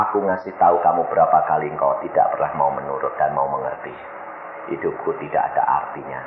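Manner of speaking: low, soft, fast reproach; subdued retold hopelessness; despairing reply. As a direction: answer as the wife who has given up. Wife's despairing answer to husband.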